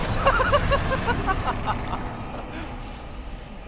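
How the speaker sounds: breathy high-pitched laugh; high giggling laugh. Real, not acted. Laughing after kill.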